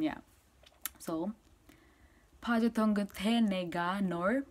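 ordinary talk

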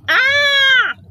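high loud scare scream; a child's scare shriek at the boy. Acted. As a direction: shriek right in his face. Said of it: "I screamed right in his face".

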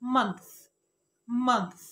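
Month said cleanly as a single syllable; correct pronunciation. Shown as a demonstration. Teacher shows viewers how to say Month. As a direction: model Month clearly. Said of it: "هقول إيه؟".

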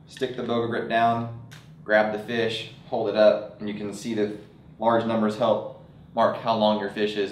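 ordinary talk, nothing marked

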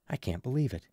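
plain reading voice

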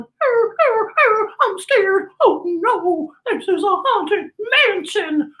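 high whimpery cartoon dog; high, frightened cartoon dog voice; scared dog. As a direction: yelp it like a frightened pup. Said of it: "his dog is going".

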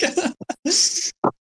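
high airy laugh; a short high amused giggle. Real, not acted. Laughing at the six-hour joke.

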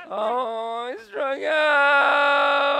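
very loud, high, drawn-out cry; anguished wail. Real, not acted. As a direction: howl at striking out again. Striking out again.

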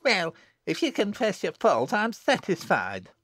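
put-on high, condescending child in dialect; haughty little girl. A narrator's reading. Jennet accepting Susan's apology, haughtily.

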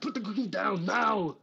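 slow hammed-up Austrian command; Arnold impression. Arnold scolding Sarah Connor over a cookie, hammed-up Austrian accent. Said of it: "Sarah Connor picked up my cookie".

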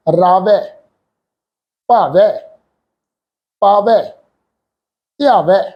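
ਰਾਵੈ said with a flat "ai" as in "rawai", the wrong way; wrong pronunciation demo. Shown as a demonstration. Teacher shows students the wrong way, reading ਰਾਵੈ flatly. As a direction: demo the incorrect reading ਰਾਵੈ, ਭਾਵੈ, ਪਾਵੈ with a plain "ai" ending. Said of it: "ਇਸ ਢੰਗ ਨਾਲ".

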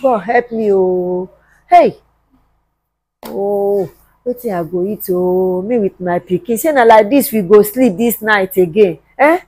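low, soft, weary moan; subdued despairing self-talk; helpless lament. Acted. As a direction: pray quietly for help, worn down by hunger. Hungry mother laments alone.

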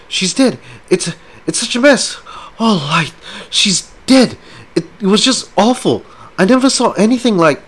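high, loud, panicked babbling; a frightened woman begging for help; distraught pleading. Acted. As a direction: rush the words like she's falling apart. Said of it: "she cried desperately".

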